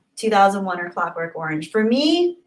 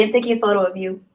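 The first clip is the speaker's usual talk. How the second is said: ordinary talk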